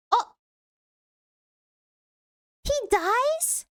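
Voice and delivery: shocked question. Real, not acted. Shock at character death.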